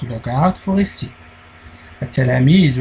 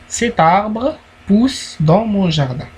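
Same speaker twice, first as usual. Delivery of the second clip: slow reading aloud; 5 semitones higher, slower than usual. Shown as a demonstration. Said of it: "reading example sentence".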